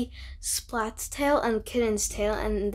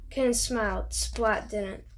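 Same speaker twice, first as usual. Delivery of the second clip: ordinary delivery, nothing marked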